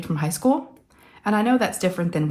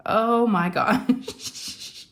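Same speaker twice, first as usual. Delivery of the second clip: slow, laughing, overwhelmed; real emotional laugh; 4 semitones higher, much slower than usual. Real, not acted. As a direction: laugh it off, very slowly. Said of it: "A mess, a big mess".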